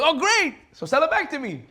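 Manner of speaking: eager relief. Acted. Widow asking the butcher to sell it back, eager. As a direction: pounce on the good news.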